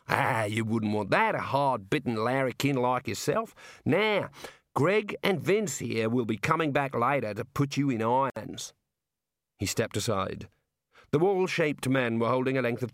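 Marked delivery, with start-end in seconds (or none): 0.1-8.7 drawn-out, cheerful Australian jailer; jovial Aussie warder. Acted. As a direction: play a chummy outback prison guard. Warder speaks to prisoner.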